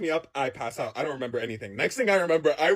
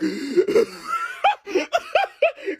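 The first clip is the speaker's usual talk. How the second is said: high-pitched wheezing gasps; exaggerated asthma-attack wheeze; acted struggle to breathe. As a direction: wheeze as if the airway is closing. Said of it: "I'm literally like".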